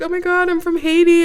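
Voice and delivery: high imitation of someone thrilled by the coincidence; mock delighted. Man tells her he is from Haiti, mock delighted. Retelling man's delighted reply.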